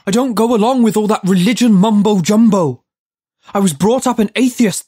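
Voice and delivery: high, loud indignant yell. A narrator's reading. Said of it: "Judd yelled".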